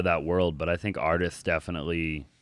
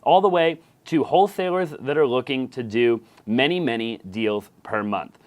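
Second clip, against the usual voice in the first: louder, punchy sponsor read, spelling out the web address slowly; 5 semitones higher, 9 dB louder, slower than usual. Acted. Podcast host reading a sponsor ad to listeners, upbeat ad voice. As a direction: sell the free consultation like a radio spot.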